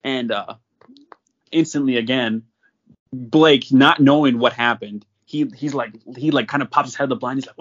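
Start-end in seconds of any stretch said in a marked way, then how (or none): none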